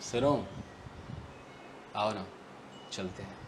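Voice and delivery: inviting someone to go, calm and soft; coolly. Shown as a demonstration. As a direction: say it calmly, unhurried. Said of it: "शांति से कहा".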